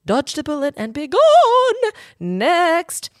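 be done with it, call the next letter; emphatic sign-off. Real, not acted. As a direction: dismiss it with a flourish.

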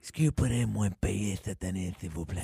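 soft, low put-on caller voice; very soft mock pleading caller; Enrique. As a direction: trail down into a hushed, polite imitation. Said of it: "en appelant avec la voix d'Enrique".

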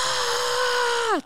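long high-pitched "aah" scream; demonstrated scream. Shown as a demonstration. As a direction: hold one high, sustained yell. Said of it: "scream at the top of my lungs".

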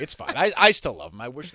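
ordinary talk

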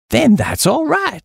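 high, drawn-out hearty acceptance. A narrator's reading. Chappie reassured by the Duke's word, hearty. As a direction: pitch it up, satisfied and hearty. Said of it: "said the chappie heartily".

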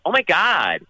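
high, astonished imitation of an American woman; American accent. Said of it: "and she's American".

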